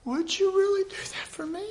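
very high, wondering falsetto; put-on high voice, hopeful and amazed; imagined divine question. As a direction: ask in tiny, touched disbelief. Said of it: "I can hear him now".

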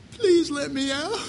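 high, pleading prisoner voice; Jesus. Pretend. Jesus calling from behind the bars of a heart, high mock pleading. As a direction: plead to be let out in a small, high voice. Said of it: "Jesus is there standing at the bar going".

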